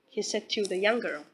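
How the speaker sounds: usual speaking voice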